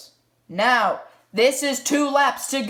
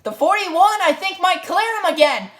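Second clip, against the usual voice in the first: motorsport commentator; 5 semitones higher than usual. Acted. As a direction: sell the decisive shove to viewers. Race call, final lap.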